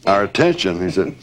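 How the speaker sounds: usual speaking voice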